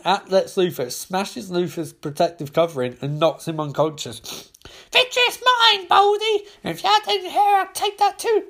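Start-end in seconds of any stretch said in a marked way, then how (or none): 4.9-8.4 much higher, squeaky gleeful creature voice; gloating little lackey. Acted. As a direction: crow over the victory like a gleeful imp. Glomulus gloating over Lex Luthor.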